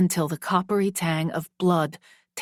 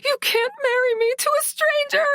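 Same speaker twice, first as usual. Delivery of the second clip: high, loud, frantic screech; desperate screech rising ever higher; 13 semitones higher, 3 dB louder than usual. Acted. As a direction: scream it at him, voice climbing. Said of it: "I screeched".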